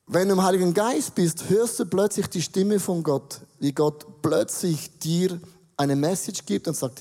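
ordinary talk

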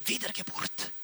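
soft, halting, near-whispered question; a timid whisper of Nikodemus; hushed Nikodemus. Acted. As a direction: ask it shyly under your breath. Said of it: "kommt der Nikodemus und sagt so".

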